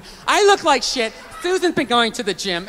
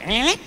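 "Eh?" with a sharply rising question tune; voice going up. Retold or imitated. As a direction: let "Eh?" slide upward at the end. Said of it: "It goes up".

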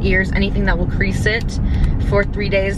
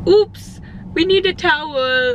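imitating hairdresser; 8 semitones higher, slower than usual. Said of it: "he's like".

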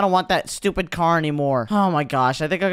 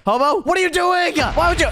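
alarmed; 13 semitones higher, 4 dB louder than usual. Real, not acted. Alarmed at hobo.

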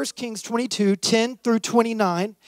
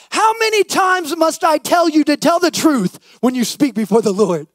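sharp, raised rebuke from the king; 6 semitones higher, 5 dB louder than usual. A narrator's reading. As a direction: snap at the prophet, a touch louder. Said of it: "the king replied sharply".

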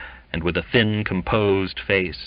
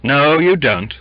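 loud, curt refusal; raised, curt veto; 7 semitones higher, 7 dB louder than usual. A narrator's reading. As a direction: cut him off with authority. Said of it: "said the master curtly".

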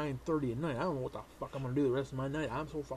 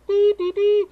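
high, loud horn-call imitation; hammed-up alphorn toot; mock alpine horn; 16 dB louder than usual. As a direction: sing it like the ad's horns.

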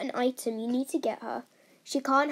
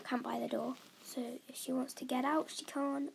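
quiet; 2 semitones lower, 12 dB quieter than usual. Real, not acted. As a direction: drop to a murmur. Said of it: "I said camp by the door".

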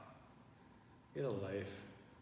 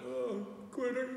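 mocking commenter voice; 12 semitones higher, slower than usual. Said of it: "all these guys would comment and be like".

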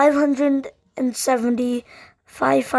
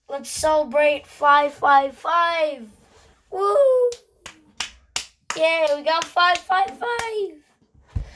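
excited cheer; 5 semitones higher, slower than usual. Real, not acted. Celebrating reaching 555.

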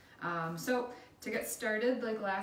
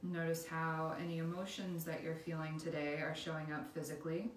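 guided meditation; 5 dB quieter than usual. Acted. Instructor leading listeners through a body scan, calm guided meditation.

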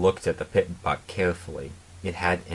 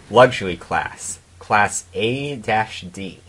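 usual speaking voice

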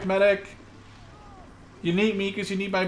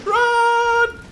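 shouting high and loud at a teammate to run; panicked shout; 13 semitones higher, 7 dB louder, much slower than usual. Real, not acted. Warning Bishop a Panzer is coming, panicked shout. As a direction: yell the warning, voice cracking upward.

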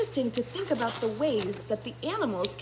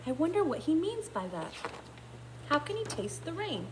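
ordinary delivery, nothing marked

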